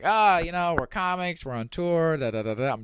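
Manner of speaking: retold nervous-light small talk; keeping it light. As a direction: play it casual and fun to defuse tension. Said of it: "trying to be real light, keep it fun".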